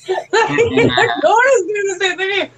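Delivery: giggling through the words; slow, laughing delight. Real, not acted. Laughing at dogs whining.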